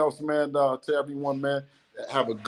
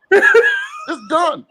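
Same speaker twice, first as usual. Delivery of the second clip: laughing gloat; 6 dB louder than usual. Real, not acted.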